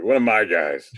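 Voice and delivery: lightly mimicked coach, called out; a hint of a hearty coach's call; imitating Coach. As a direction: echo the host as a mock coach. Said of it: "Now you sound like Coach".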